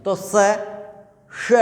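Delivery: "स" and "श" as two distinct, separated hisses; correct sibilant demonstration. Shown as a demonstration. Teacher modelling the sibilants, "स, श" spaced far apart. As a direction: pause after "स", then give "श" its own place. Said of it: "ऐसे इनका उच्चारण होना चाहिए".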